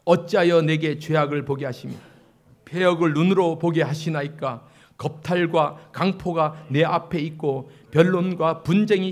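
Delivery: loud, measured congregational recitation; scripture reading aloud. Real, not acted. Pastor reading the prophet's lament with congregation, loud scripture recitation. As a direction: lead the congregation in reading, firmly projected.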